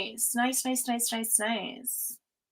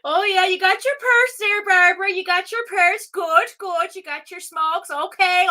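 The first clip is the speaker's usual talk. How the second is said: put-on much higher, loud party-girl voice from Newfoundland; Tuna Tina character; 9 semitones higher, 5 dB louder than usual. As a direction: ham up a giddy Newfoundland woman heading out with her girls. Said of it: "But he says stuff like".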